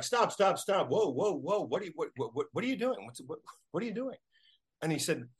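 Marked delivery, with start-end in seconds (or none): 0.0-4.2 startled. Retold or imitated. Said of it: "Lapine's like".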